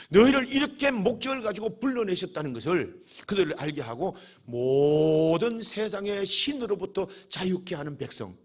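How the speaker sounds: ordinary delivery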